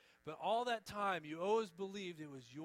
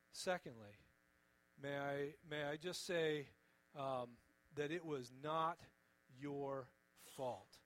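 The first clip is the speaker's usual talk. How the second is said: ordinary talk, nothing marked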